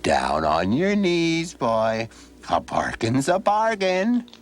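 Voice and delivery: high and drawn out, savoring his win; stern old gentleman pronouncing the deal; slow, triumphant old man. Acted. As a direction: gloat as you order him down. Old man claims his bargain.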